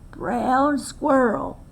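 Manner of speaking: put-on slow, gentle old grandmother calling his name; wise grandmother. Grandmother warning young Squirrel: slow gentle old voice. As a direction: call him slowly like a wise old granny. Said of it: "his grandmother had said".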